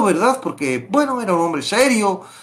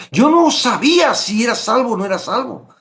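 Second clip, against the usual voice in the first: ordinary talk, nothing marked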